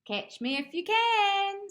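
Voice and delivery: high, slow sing-song tease; sing-song cat teasing from above. Acted. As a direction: taunt him in a lofty cat voice.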